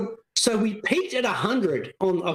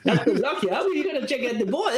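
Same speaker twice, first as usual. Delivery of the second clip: usual speaking voice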